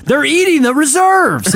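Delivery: high-pitched, hammed-up movie climax; mock outraged accusation. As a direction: cry it out like a scandalized whistleblower. Acts out climactic boardroom movie line.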